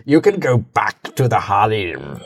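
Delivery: low, slow, smug villain drawl; a gloating ruler dismissing his servant; Imperator voice. Acted. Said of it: "the Imperator voice".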